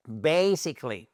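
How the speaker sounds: "Basically" with a touch of nasal American a; slightly nasal. Shown as a demonstration. Teacher showing the nasal sound to students. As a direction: give "Basically" a bit of nasal twang. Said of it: "faire ce son un peu nasal".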